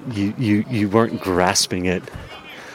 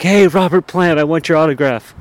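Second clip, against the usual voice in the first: passerby calling out; 8 semitones higher, 6 dB louder than usual. Retold or imitated. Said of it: "he's like".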